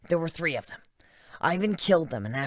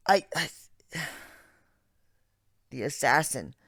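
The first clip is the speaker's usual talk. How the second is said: plain reading voice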